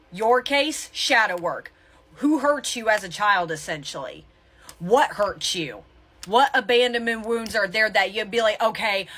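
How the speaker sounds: ordinary talk, nothing marked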